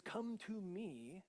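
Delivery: a slow, open-armed come to me; gentle invitation. Acted. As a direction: draw them in, unhurried. Quoting Jesus's invitation.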